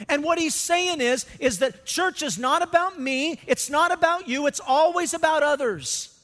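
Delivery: loud, high preaching that drives each line home; full-force preaching. Acted. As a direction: preach it out to the whole room. Opening of sermon at full force.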